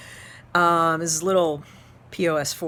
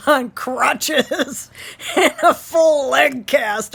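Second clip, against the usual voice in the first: slower words broken by laughter; laughing; 5 semitones higher, 5 dB louder, much slower than usual. Real, not acted. Teacher picturing dad on crutches, laughing. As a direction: retell it laughing.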